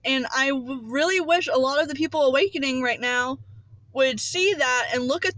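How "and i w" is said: usual speaking voice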